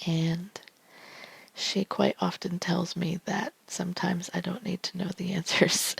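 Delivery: ASMR whisper reading tarot cards; hushed ASMR card reading; soft whisper. Real, not acted. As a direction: breathe each card's message softly. Whispered personal aside.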